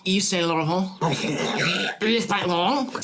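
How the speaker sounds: mock French, strangled as if choking; exaggerated French accent through a choke. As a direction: choke out a French name, drawing out the second. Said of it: "sound like you're speaking in a French accent".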